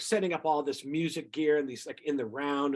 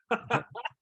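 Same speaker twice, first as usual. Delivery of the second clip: short real laugh, higher; a quick amused laugh; brief chuckle at his own story; 6 semitones higher than usual. Real, not acted.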